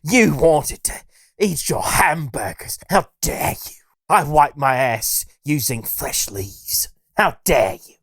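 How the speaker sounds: mock-scolding, a hint of tearful outrage; mock Greta Thunberg. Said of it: "the climate girl who says".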